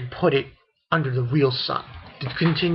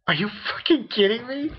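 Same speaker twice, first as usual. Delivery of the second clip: mock disbelief; 9 semitones higher than usual. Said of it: "And I'm like".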